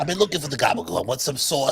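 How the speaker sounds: quick Italian-American patter with a laugh in it; Uncle Vinny. Acted. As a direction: ham up the Italian-American food craving. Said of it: "don't I sound like your Uncle Vinny".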